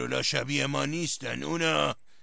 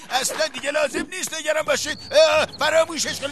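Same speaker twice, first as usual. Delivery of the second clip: high, louder, nervous brush-off; nervous dismissal; 12 semitones higher, 6 dB louder than usual. Acted. Father hides the letter from his daughter, high and nervous.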